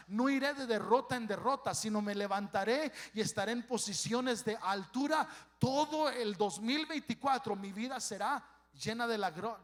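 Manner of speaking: loud, driving declaration of victory; full-force preaching. Acted. Pastor declaring the congregation's victory for the year, loud preaching. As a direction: shout the promise over the whole church.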